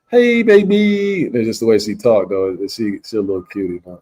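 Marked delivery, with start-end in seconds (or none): 0.1-1.3 high, drawn-out Brazilian Portuguese flirting; Brazilian Portuguese accent. Retold or imitated. Imitating a cutie who texts him, high Brazilian Portuguese accent. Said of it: "Portuguese accent".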